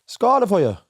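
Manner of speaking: hammed-up Irish accent, bright and loud. Shown as a demonstration. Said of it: "Go on, say it".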